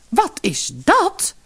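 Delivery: drawn-out, high astonished cry. A narrator's reading. Mother amazed at what fell from her daughter's mouth, astonished. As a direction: stretch it out in sheer amazement. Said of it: "riep haar moeder stom verbaasd uit".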